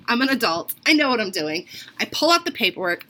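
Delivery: ordinary delivery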